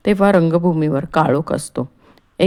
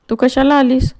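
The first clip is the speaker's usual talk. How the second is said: high, loud snap at his wife; sharp snap; 8 semitones higher, 5 dB louder than usual. Acted. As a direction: cut her off sharply. Sick man snaps at wife. (पत्नीवर उंच, मोठ्या आवाजात खेकसणे)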